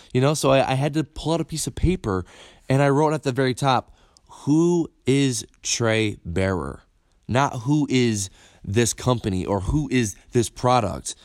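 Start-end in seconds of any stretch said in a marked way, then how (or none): 4.4-6.8 each word drawn out slowly; slow, weighty self-question; slow, deliberate question. Retold or imitated. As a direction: read the question like a headline. Reads his written question.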